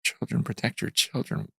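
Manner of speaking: an awed hush, barely voiced; hushed whisper. Retelling the voice of God to the host in an awed whisper. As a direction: whisper it, breathy and unvoiced.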